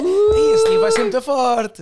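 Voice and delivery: loud, slow laughing exclamation; excited laughing. Real, not acted. (exclamação a rir alto)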